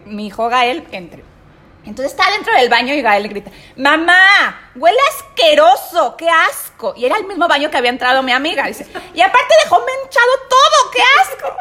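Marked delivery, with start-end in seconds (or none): 3.8-6.9 put-on high, loud kid's yell, slow and disgusted; child yelling. Son yelling from bathroom stall. 9.1-11.6 put-on much higher squeaky kid voice, loud; disgusted child. Son yelling about the mess.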